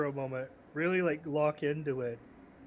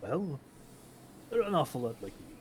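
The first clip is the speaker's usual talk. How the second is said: smug grin; 4 dB quieter than usual. Acted. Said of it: "a half shit-eating grin".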